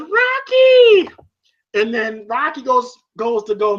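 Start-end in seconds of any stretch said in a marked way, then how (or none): 0.0-1.1 very high, loud drawn-out call; Adrian's cry. Retold or imitated. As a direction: imitate a wife screaming for her husband. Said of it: "Adrian goes".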